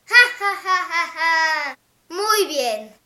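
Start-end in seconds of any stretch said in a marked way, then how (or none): 0.1-1.8 mock laugh. Mouse laughs at the gruffalo.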